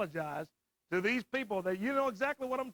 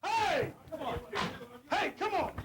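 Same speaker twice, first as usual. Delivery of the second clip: high, urgent yells to break it up; raised, frantic calls to stop; alarmed shouting; 7 semitones higher, slower than usual. Real, not acted. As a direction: shout in alarm at a sudden fight. Alarmed host as a brawl breaks out.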